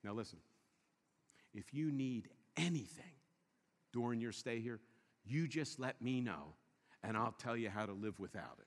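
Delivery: soft, low, hushed attendant voice; monastery attendant. Retold or imitated. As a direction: speak softly like a monk. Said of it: "To your room and says".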